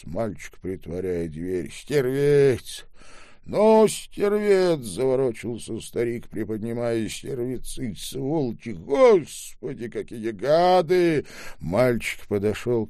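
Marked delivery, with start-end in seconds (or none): 1.7-2.8 put-on louder indignant old man; angry old man. A narrator's reading. Old man repeats the insult. 3.4-4.8 much higher, louder and slow; furious old man. A narrator's reading. Old man repeats, escalating. 8.7-11.2 put-on higher, louder self-pitying old man; bitter old man. A narrator's reading.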